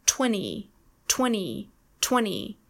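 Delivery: twenty without its second T; silent T. Shown as a demonstration. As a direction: say twenty as "twenny". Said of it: "the T is completely silent".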